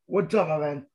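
ordinary talk

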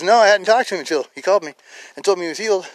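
high, loud emphatic denial; retold excited reply. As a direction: answer with amazed insistence. Brother's amazed reply, retold.

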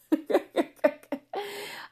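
high laughter at her own joke; real laugh. Real, not acted. Laughs after her yoga joke.

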